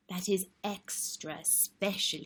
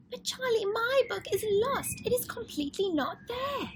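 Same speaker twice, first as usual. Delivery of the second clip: put-on high excited little girl voice, pleading; little sister Lola. Lola begging Charlie for her favourite book, high and pleading. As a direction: plead like an eager small girl. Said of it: "Lola says".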